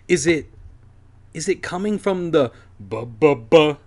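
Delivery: stammering in fear; scared stutter. Acted. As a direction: trip over the words, scared. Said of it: "I was stuttering".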